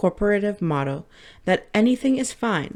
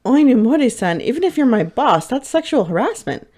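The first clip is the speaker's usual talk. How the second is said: higher, louder indignant protest; 6 semitones higher, 6 dB louder than usual. Acted. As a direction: call her out sharply. Protesting boss's harassment.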